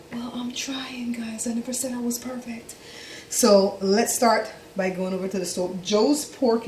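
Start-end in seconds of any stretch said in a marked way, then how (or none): 0.1-2.7 whisper. Real, not acted. Answering critics of her folding.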